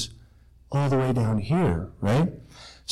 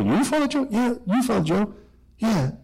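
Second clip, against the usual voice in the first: 1 mock disbelief; 8 semitones higher than usual. People disbelieving he's shy.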